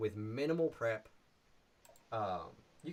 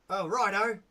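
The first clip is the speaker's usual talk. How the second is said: Toblin voice; 7 semitones higher, 8 dB louder than usual. Shown as a demonstration. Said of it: "will be".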